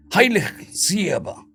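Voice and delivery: a lightly voiced German accent; heavy German accent. A narrator's reading. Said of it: "speaking in a heavy German accent".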